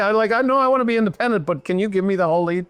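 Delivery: put-on high, pleading teen daughter voice, words rushing; whiny daughter. Daughter begging mom for the whole lead, high whiny plea. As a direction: whine like a daughter who wants the whole lead. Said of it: "Which is like".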